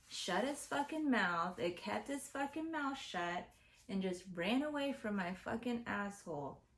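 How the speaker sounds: usual speaking voice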